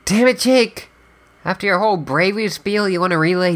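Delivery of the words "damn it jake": exasperated outburst. Acted. Exasperated at Jake's double apology.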